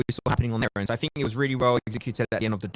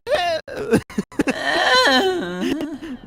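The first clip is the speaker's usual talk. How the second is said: loud burst of laughter; 14 semitones higher, 4 dB louder than usual. Real, not acted. Laughing at co-caster's voice crack.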